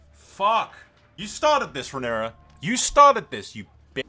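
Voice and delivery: high, loud angry yell; real anger; furious outburst. Real, not acted. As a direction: rage at the screen. Furious reaction to the queen.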